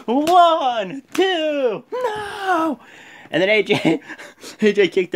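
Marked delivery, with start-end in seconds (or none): 0.1-2.8 referee count. Retold or imitated. Referee counting the pin, then a shocked high no. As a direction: count it out like a ref who can't believe the kickout. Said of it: "he's like".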